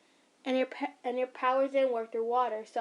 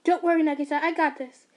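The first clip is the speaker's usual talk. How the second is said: loud; 4 semitones higher, 8 dB louder than usual. Acted. Mother reassuring son mid-fight.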